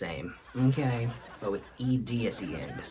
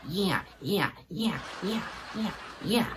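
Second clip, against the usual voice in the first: higher-pitched repetitive cartoon yammer; repeated yeahs, pitched up; Beavis and Butthead; 6 semitones higher, 4 dB quieter than usual. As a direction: imitate a coffee-crazed cartoon character. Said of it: "was technically high on coffee".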